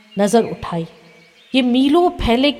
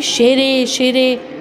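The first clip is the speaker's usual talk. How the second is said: calling out; 3 semitones higher, much slower than usual. A narrator's reading. Retold call breaks into his thoughts.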